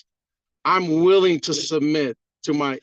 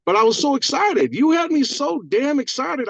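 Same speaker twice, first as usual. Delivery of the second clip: excited; 9 semitones higher than usual. Real, not acted. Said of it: "little excited. Let me calm down".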